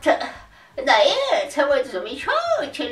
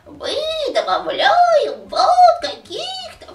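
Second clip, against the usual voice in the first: put-on high, slow old man's notes; higher notes; 13 semitones higher, 5 dB louder, slower than usual. Shown as a demonstration. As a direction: lift the voice into thin high notes. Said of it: "выше".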